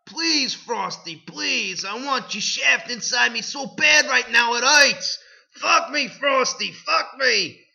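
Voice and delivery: high, pleading mock cries; hammed-up begging. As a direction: beg breathlessly, overdone. Heroine begs in read fanfic.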